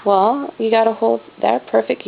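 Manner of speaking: ordinary delivery, nothing marked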